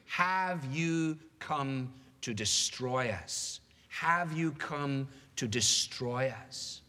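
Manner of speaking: very slow, word by word, with pauses; slow, ominous question. Acted. Demon asks Jesus if he will destroy them, slow and ominous. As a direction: spell out the demon's question slowly and heavily.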